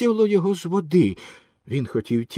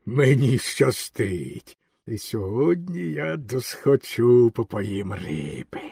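slow, lower, drawn-out monster voice; pleased monster fisherman; 4 semitones lower, slower than usual. A narrator's reading. Green fisherman gloats over his catch, slow self-satisfied voice. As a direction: savour it slowly in a put-on ogre voice. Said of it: "рибалка задоволено вигукнув".

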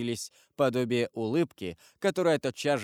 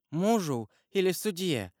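frightened murmur; 6 semitones higher than usual. A narrator's reading. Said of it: "пролепетала госпожа де Вильфор".

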